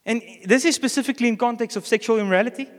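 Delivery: usual speaking voice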